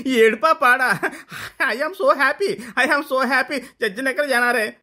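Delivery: put-on high, laughing, gleeful child voice; laughing prince. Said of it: "పక్కున నవ్వి".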